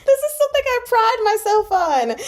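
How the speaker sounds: very high, loud squeal of dismay; mortified wail. Real, not acted. Reader whose pride is hurt by a mistake, wailing. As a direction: squeak it out, voice pitched right up.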